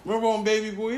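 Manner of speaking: ordinary talk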